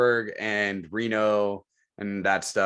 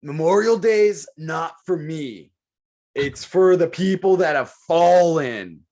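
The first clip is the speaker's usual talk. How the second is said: slow, louder and higher, mocking earnest patriots; mock solemn preaching; 4 semitones higher, 5 dB louder, slower than usual. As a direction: drag it out like a pious sermon, making fun of it. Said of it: "you have the guys that are like".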